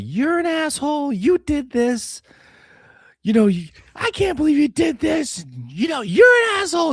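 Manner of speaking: high, loud furious wife voice, imitated; imitating his wife yelling at him when he gets home; mock angry wife. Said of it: "and she's like".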